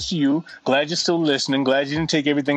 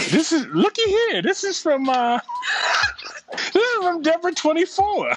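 high, giggling through the words; cracking up while reading; laughing. Real, not acted. Laughing reading next email.

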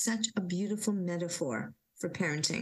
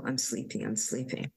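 sleepy murmur; 7 semitones lower than usual. Retold or imitated. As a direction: lull yourself to sleep. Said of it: "And then I go into".

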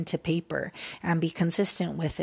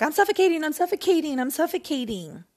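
high, loud mock panic, gasping complaint; hammed-up cry of suffocation; whining that the blanket is too heavy; 8 semitones higher, 4 dB louder than usual. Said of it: "You can't be laying on top of a blanket and like".

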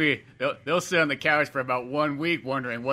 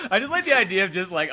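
usual speaking voice

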